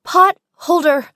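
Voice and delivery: high, loud, urgent call; Lily shouts the missing word at Ryle, high and alarmed. Acted. Lily finishes her warning to Ryle, loud panicked cry.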